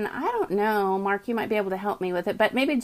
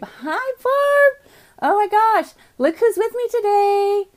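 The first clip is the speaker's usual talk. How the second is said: high, louder delighted hello; thrilled to see a friend; excited greeting; 11 semitones higher, 5 dB louder than usual. Real, not acted.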